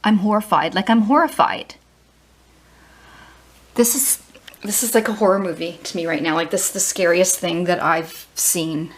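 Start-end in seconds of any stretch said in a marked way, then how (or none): none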